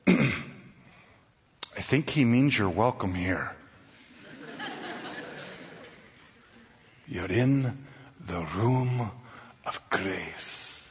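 soft, gentle welcoming hostess; beautiful hostess voice. Retold or imitated. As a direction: greet him like a kind host, softly. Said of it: "most beautiful voice that I've ever heard".

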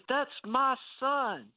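high, slow mock holler; imitating proud dad. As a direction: announce it up high, stretched out, as Dad. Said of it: "He said".

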